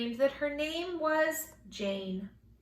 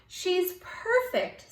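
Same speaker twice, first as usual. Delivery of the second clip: tender awe; 7 semitones higher, much slower than usual. Acted. Mother adores newborn.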